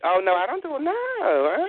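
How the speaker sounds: put-on refusal climbing to a high uh-uh. Real, not acted. Refusing a caller's sneak-peek request in a put-on voice. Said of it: "you ain't gotta come out that voice".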